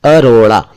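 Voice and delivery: अरोड़ा said with a tongue-flicked ड़ in the middle; अरोड़ा, flap the ड़ sharply; clear retroflex flap. Shown as a demonstration. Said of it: "अरोड़ा ही बोला करिए".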